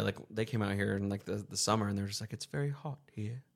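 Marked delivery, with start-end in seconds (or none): 2.3-3.4 put-on quiet Australian accent; lightly mocked Australian accent. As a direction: imitate an Australian uncle, softly. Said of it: "my uncles who are from Australia".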